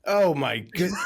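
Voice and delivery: amused laughing reply. Real, not acted. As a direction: laugh through it in disbelief. Laughing at the story.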